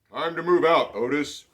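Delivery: bright hearty farmer call. Acted. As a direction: call him out of the stall. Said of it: "the farmer said".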